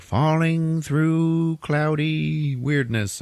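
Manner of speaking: high, slowly stretched words; drawn-out mock falling. Telling of the Joker falling through the Phantom Zone, high and drawn out. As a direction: draw each word out as if tumbling through space. Miming the Joker's fall in retelling.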